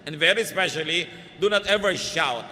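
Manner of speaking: loud, forceful stress on "do not ever shout"; emphatic admonition. Real, not acted. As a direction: drive home "do not ever shout" with weighty volume. Climax of repeated admonition.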